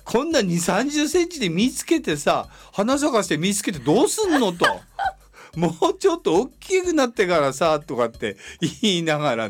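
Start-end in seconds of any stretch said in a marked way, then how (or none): 0.0-7.8 teasing a plant, raised pitch; mock scolding. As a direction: scold it like a hasty child. Scolding the early tomato.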